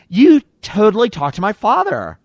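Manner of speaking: high, loud, exaggerated girly accusation; Monica Lewinsky. Monica confronts host about her father, high exaggerated impression. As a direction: pitch it way up and punch it out. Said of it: "she doesn't talk like that".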